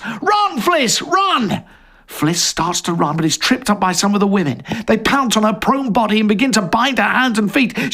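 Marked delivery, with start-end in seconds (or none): 0.0-1.6 high urgent shout. Acted. Jerry shouting to Fliss to escape, high and urgent. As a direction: yell at her to flee. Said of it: "and shouts".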